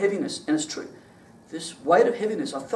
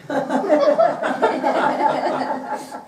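a high burst of real laughter; giggling at his own confession; 8 semitones higher than usual. Real, not acted. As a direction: laugh at the absurd fear. Laughing about getting hurt.

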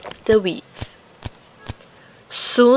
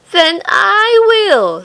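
high, slow, louder hen voice; determined hen; 10 semitones higher, 6 dB louder, slower than usual. A narrator's reading. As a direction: say it like a hen doing it herself. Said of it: "said the little red hen".

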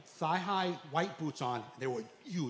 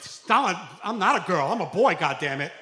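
loud mock indignant protest; his younger self protesting loudly; 7 dB louder than usual. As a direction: push back loudly, half laughing. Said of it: "at first I was like".

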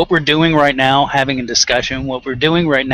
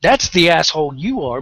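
ordinary talk

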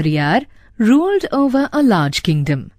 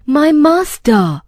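mild protesting cry, higher and slow; 7 semitones higher, slower than usual. A narrator's reading. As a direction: call out in slow, lightly dramatised appeal. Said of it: "cried the barber".

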